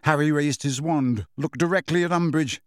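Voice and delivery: ordinary delivery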